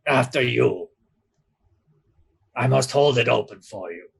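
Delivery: put-on courteous light Severosi accent; a hint of Severosi accent, holding the door. Doorman ushering the crew through the mirror in a light Severosi accent. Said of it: "And he goes".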